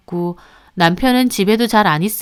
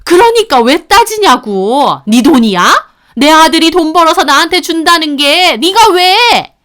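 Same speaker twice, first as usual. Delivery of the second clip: mother-in-law shouting at daughter-in-law over the money; 7 semitones higher, 7 dB louder than usual. Acted. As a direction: hammer each word at her. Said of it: "어머님이 계속 소리를 지르셨고".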